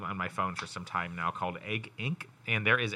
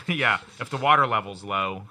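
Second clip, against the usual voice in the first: ordinary talk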